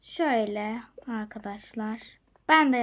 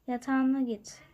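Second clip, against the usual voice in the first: ordinary talk, nothing marked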